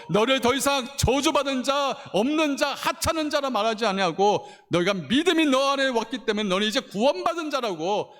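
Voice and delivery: high, emphatic pulpit voice; impassioned preaching. Acted. Pastor explaining release from the curse to believers, impassioned preaching.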